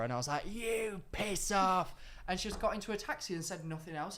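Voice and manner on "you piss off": high, drawn-out mock outburst; re-enacted angry retort, pitched up and very slow; retold losing it. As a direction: drag it out as an indignant comeback. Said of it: "lost it at her".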